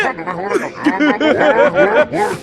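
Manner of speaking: rattling drawn-out cackle; long laugh. Real, not acted. Streamer cracking up at the toaster line, long cackle.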